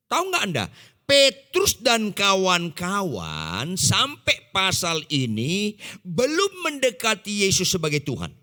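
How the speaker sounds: usual speaking voice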